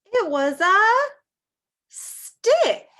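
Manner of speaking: loud, high reveal of stick; spring the surprise on them; excited reveal. A narrator's reading. Reading to children, loud excited reveal.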